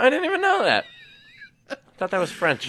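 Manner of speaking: high squeal through laughter; laughing disbelief. Real, not acted. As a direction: crack up in mock shock. Mock surprise, laughing.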